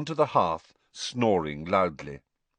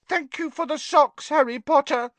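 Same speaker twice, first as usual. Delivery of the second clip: high, sad house elf calling; miserable elf call; 5 dB louder than usual. A narrator's reading. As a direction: call out forlorn little elf. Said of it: "Dobby called miserably".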